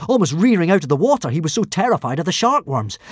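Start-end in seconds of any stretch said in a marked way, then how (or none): none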